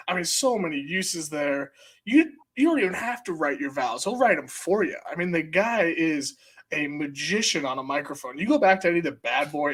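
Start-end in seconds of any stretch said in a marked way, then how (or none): none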